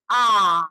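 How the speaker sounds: आ held long and low, mouth wide open; open vowel demo. Shown as a demonstration. Teacher showing students an open vowel, drawn-out demonstration. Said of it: "अधिक खुलता है। जैसे".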